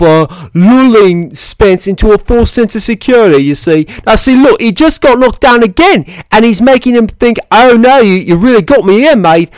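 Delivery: high, loud, badly faked British accent; hammed-up British fight fan, pitched high and insistent; terrible British accent. Imitating a biased British fan defending Brook, terrible British accent. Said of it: "that is my terrible British accent".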